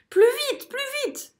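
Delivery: cris très aigus; pressant. A narrator's reading. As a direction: presser les autres. Urging takeoff. (merle qui presse en criant)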